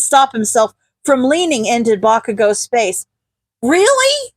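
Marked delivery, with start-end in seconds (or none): 3.6-4.3 much higher, delighted squeak; thrilled high Really; excited disbelief. Acted. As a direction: burst out with joyful surprise. Izuku thrilled at the yes.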